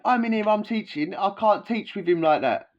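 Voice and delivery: officer defends himself, mock voice; imitating the officer. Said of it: "He went".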